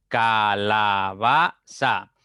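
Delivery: "Calabaza" split loudly into Ca - la - ba - za; projected, very slow "Calabaza", syllable by syllable; slow, measured pronunciation. Shown as a demonstration. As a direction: sound out "Calabaza" in four long, separate syllables. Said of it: "si ustedes pronuncian pausadamente".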